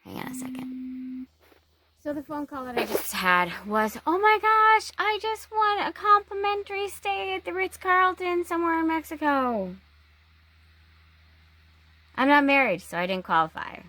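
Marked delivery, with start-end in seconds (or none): 4.1-9.8 high-pitched mock-thrilled gush, easing down toward the end; gushing prize excitement. Retelling a phone call about winning a Ritz-Carlton stay, mock-excited. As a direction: gush like a hammed-up prize winner.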